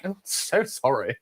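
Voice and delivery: sheepish apology. Retold or imitated. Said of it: "And I'm like".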